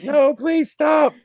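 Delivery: high, drawn-out mock plea; mock pleading. Women beg the fighters to stop, high mock pleading. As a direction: squeal it long and high, imitating her.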